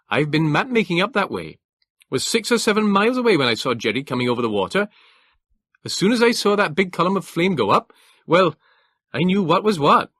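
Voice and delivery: put-on a trooper reporting in his northeast England accent. A narrator's reading. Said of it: "the clipped, Teutonic accent of the northeast of England".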